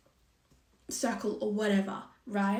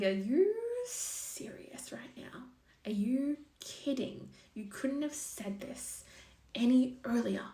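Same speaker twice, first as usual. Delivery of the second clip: high, slow, stunned disbelief; 4 semitones higher than usual. Retold or imitated. To a lover who confessed too late, high slow disbelief. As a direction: stretch it out in shocked disbelief.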